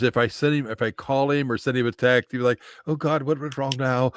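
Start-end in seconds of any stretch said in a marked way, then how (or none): 2.9-4.1 worried boss impression. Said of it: "That was a good accent".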